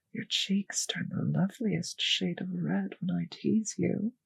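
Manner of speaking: normal talking voice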